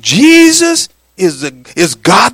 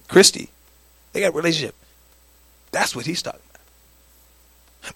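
tender quiet; 5 semitones lower, 9 dB quieter, faster than usual. Real, not acted. Quiet intimate examples.

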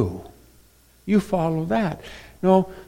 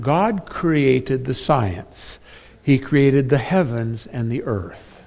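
usual speaking voice

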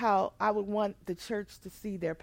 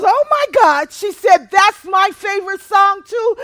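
shouting with joy, high and loud; excited; 7 semitones higher, 14 dB louder than usual. Retold or imitated. Nurse shouting with delight about the same favorite song. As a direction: burst out delighted at the shared favorite.

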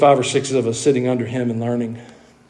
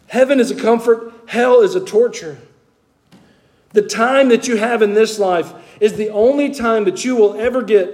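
raised, earnest preaching voice; 6 semitones higher than usual. Real, not acted. Preacher to the congregation, pitched high and urgent. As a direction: press the truth of heaven and hell on the church.

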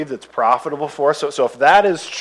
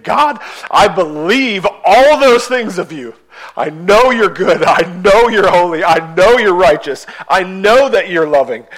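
higher, louder heartfelt plea to God; wrestling prayer; 5 semitones higher, 8 dB louder than usual. Retold or imitated. Voicing a believer crying out to God in prayer, higher and louder. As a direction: cry it out to God like a believer who trusts yet struggles. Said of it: "they're wrestling questions and saying".

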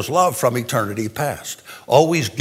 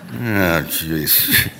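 laughing; 3 semitones lower, 6 dB quieter than usual. Real, not acted. Laughs at own miscount.

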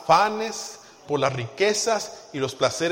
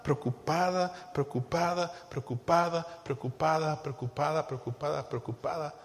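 steady looping chant, fading softer; droning repetition; 5 dB quieter than usual. Shown as a demonstration. Preacher showing a mind stuck in worry, droning and fading. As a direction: repeat it like a worry circling, dwindling away.

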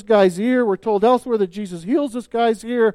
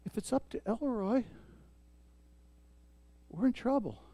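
much softer, intimate and close; hushed sermon aside; 2 semitones lower, 9 dB quieter than usual. Real, not acted. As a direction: drop to a near hush to draw them in. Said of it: "intimate soft point about safety".

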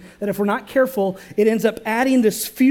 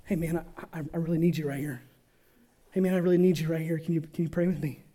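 soft, hurried pleading; quiet urgent plea; 4 semitones lower, 7 dB quieter, faster than usual. Acted. Jesus begs his sleepy disciples to stay, quiet and hurried. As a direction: beg your friends softly to stay.

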